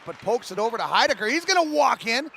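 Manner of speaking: quick call climbing sharply in pitch; excited play-by-play. Real, not acted. Commentator sees Heidecker walking in on net, excited. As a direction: build to a high, urgent peak. Live call of a sudden chance.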